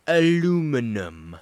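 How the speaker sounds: loud, slow, drawn-out Aluminum; too loud. A narrator's reading. Drunk girlfriend teasing him, too loudly. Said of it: "Then, too loudly".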